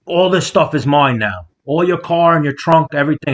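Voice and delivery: high-pitched mock rant; imitated ranting kid. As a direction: lightly act out a wild kid claiming everything. Imitates the kid claiming his stuff.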